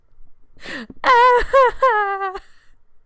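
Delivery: loud, high fake bawling; hammed-up high sobbing howl; mock crying wail. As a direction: bawl in comic despair. Retells despair at oversleeping.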